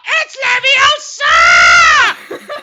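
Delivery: very high, very loud, very slow shriek; Hermione impression. Mocking Hermione fixing the pronunciation, high slow shrieking correction. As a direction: screech it like an outraged know-it-all. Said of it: "my world-renowned ... impression of".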